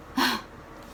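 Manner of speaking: breathy sigh mid-sentence; weary sigh. Acted. Mother logging another day stuck at home, weary sigh. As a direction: sigh before going on.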